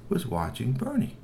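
plain reading voice

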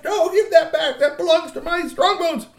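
put-on very high, loud child troll shout; frantic protest. A narrator's reading. Girl beating the troll on the head, frantic protest. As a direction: shriek the demand on one high note.

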